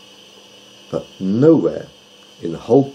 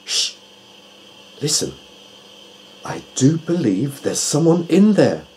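lightly voiced owl, hushed start then higher; Tutu the owl; 7 semitones higher than usual. A narrator's reading. Owl warns friends of someone behind the door, alert. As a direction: alert owl urging the others to listen.

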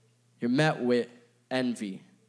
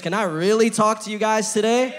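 higher and louder call to the crowd; loud rallying call; 7 semitones higher, 7 dB louder than usual. Real, not acted. Preacher pressing the congregation for a louder answer, higher and louder. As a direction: push them harder to answer. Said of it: "I don't sound convinced".

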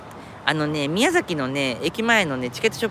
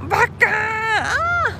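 self-scolding wail; much slower than usual. Real, not acted. Scolding himself after the slip.

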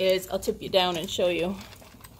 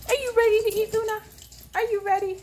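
high excited pet talk; 13 semitones higher, 4 dB louder, faster than usual. Real, not acted. Excited dog feeding time.

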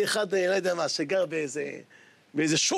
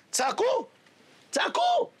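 cries pitched far higher, building; shouting high; much slower than usual. Acted. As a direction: echo the students' cry, climbing. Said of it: "כך צעקו".

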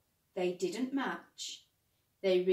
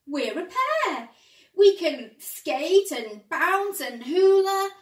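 loud, slow, high happy voice; overjoyed; 8 semitones higher, 12 dB louder than usual. Acted. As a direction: savour every word with joy. Simon overjoyed.

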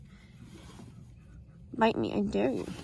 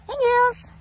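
high, loud greeting to the birds; 10 semitones higher, 6 dB louder than usual. Real, not acted. Greeting her cochin hens, high and bright. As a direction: call out sweetly to the flock.

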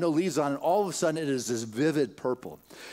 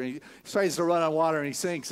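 ordinary talk

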